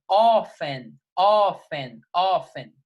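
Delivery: often said as OFF-en, the T silent; often without the T. Shown as a demonstration. Teacher drills often with a silent T. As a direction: say often with no T sound, slowly. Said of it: "a gente não pronuncia o T".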